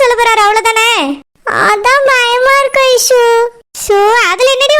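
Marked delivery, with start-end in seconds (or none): 1.7-3.2 slow, hesitant, scared admission; slow timid fear. Acted. Durga confessing her fear to her friend, slow and timid. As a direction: let the fear slow each word.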